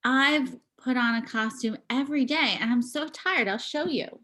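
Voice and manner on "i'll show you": normal talking voice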